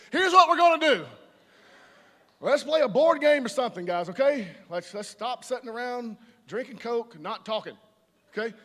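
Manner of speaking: rally the room like the life of the party; booming host rounding everyone up; bossy party starter. Retold or imitated. Party person takes charge.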